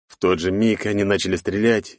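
ordinary delivery, nothing marked

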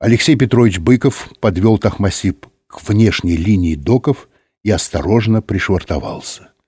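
ordinary delivery

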